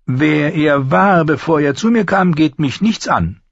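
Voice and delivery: high, firm craftsman voice; high-voiced smith shutting down questions; master armourer, unyielding.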